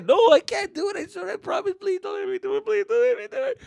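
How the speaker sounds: very high tearful pleading; panicked begging child. Acted. Kid to dad before the ride, frantic high begging. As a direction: beg like a terrified kid, hammed up.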